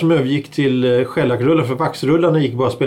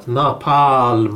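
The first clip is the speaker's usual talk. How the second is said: Napalm, lower, second A drawn long; stress on second A; 4 semitones lower than usual. Shown as a demonstration. Host showing what the dot means, Napalm stressed on second A. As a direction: stretch the stressed last A in Napalm. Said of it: "Då är det betoning på A".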